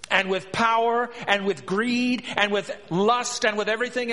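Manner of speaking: forceful preaching. Real, not acted. Preacher urging listeners to hear the proud king, forceful. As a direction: hammer each phrase home to the congregation.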